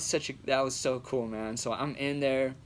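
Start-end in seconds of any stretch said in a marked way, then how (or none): none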